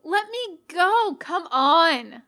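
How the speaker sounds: loud, high demand to be let go; struggling yell. A narrator's reading. Becky yells at Sans to release her, loud struggle. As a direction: shout while twisting free.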